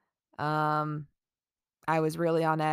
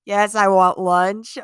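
louder, slow, drawn-out eager shout; excited yes; 2 semitones higher, 10 dB louder, slower than usual. Retold or imitated. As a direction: burst out with hungry relief. Offered lunch after being stranded.

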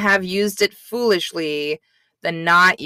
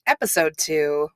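ordinary talk

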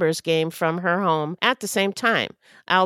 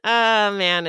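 ordinary talk

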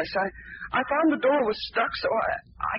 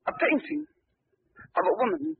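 usual speaking voice

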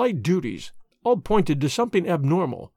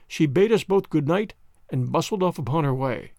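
usual speaking voice

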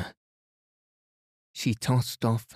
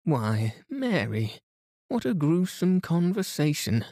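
put-on high, raised older lady's exclamation; cried out; 8 semitones higher than usual. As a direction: exclaim in mock horror, pitched high. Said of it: "cried Missus Inglethorpe".

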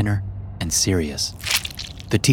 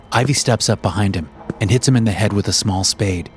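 plain reading voice

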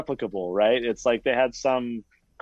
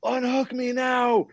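mock panicked screaming; 10 semitones higher than usual. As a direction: plead like you're strapped to the chair. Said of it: "screaming bloody murder".

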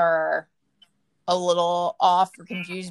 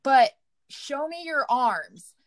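demanding; 8 semitones higher, 3 dB louder, slower than usual. Retold or imitated. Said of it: "And I was like".

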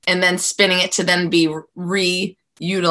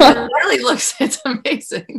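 amazed laughing; 8 semitones higher, 5 dB louder than usual. Real, not acted. Host delighted, laughing at the build.

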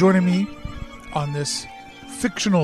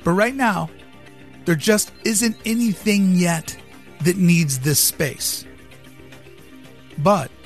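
dramatic speech; 5 dB louder than usual. Acted. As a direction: deliver it like a keynote pitch. Dramatic visionary monologue over music.

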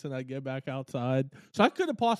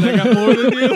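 teasing shout; 8 semitones higher, 17 dB louder than usual. Real, not acted. Teasing a rival loudly.

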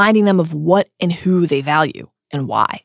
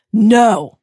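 cry; 5 semitones higher, 6 dB louder than usual. Acted. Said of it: "a dreadful cry pierces the air".

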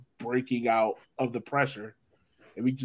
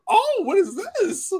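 much higher, slow hammed-up astonishment; mock surprise; 3 dB louder, slower than usual. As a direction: act baffled in a put-on voice. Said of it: "We were like".

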